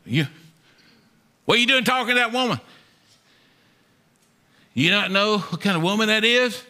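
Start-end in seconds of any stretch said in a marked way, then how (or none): 1.5-6.6 mock scolding disciples.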